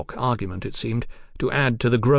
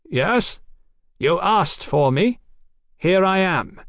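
high, projected answer; calling back; 8 semitones higher, slower than usual. Acted. Doctor answers his enemy below, calling back. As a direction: call back across a distance.

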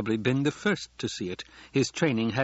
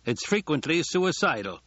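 raised, pointed public remark; addressed to no one; 6 semitones higher than usual. Acted. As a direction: pitch it over the guests' heads, higher. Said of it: "spoke as though to no one in particular".

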